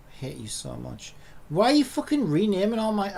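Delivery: usual speaking voice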